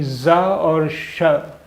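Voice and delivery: जा and शा drawn out slowly as model sounds; slow sound demo. Shown as a demonstration. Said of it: "इसका उच्चारण होता है".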